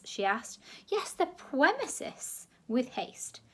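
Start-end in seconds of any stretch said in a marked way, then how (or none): 0.9-2.5 a plummy official begging for order; posh gentleman. A narrator's reading. Posh director repeats.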